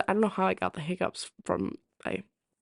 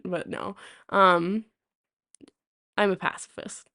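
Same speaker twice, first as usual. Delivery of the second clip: normal talking voice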